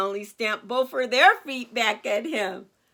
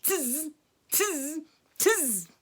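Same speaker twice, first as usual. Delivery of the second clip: short buzzing monkey chatter; mock him with little monkey noises; mimicking monkeys; 3 semitones higher, much slower than usual. A narrator's reading. Monkeys copying the scolding peddler, teasing chatter. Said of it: "the monkeys only shook their fingers".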